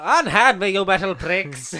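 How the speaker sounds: hammed-up Matt Berry as Troughton; loud theatrical unhand me; hammy stage actor. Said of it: "get your Matt Berry doing Patrick Troughton stuff".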